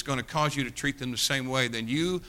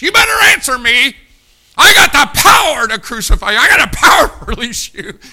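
much higher, much louder furious shout; Pilate shouting; 12 semitones higher, 18 dB louder than usual. Pretend. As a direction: roar at the prisoner. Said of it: "he's telling Jesus".

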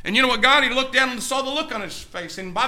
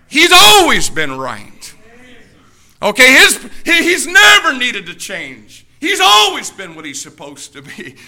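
loud, slowing preacher's cry; full-force preaching; 9 dB louder than usual. Real, not acted. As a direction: drive it home from the pulpit.

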